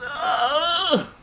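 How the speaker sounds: high stretching "oh"; drawn-out high sound of a waking stretch; waking stretch noise. Acted. Girl waking up and stretching, high sleepy groan.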